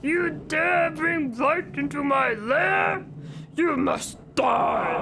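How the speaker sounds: slow, forceful villain voice; drawn-out, theatrical death sentence; Ganon. Acted. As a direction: proclaim it as an evil overlord, every word stretched. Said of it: "I can do the Ganon voice".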